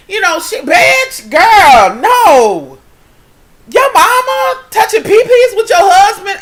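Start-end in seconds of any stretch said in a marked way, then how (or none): 0.6-2.8 outraged disbelief. Real, not acted. Talking to viewers about the mom, outraged no. 3.7-6.4 high, loud, appalled question; scandalized shout. Real, not acted. Asking the daughter about her mom and husband, scandalized shout.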